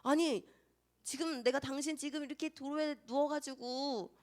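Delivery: climbing to a high, outraged protest; indignant outburst. Retold or imitated. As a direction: act out being falsely accused, rising to a shout. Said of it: "그렇게 화를 낼 것 같지만".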